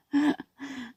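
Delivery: high breathy laugh through tears; teary laugh. Real, not acted. Laughing through tears as the song message hits. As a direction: laugh while welling up.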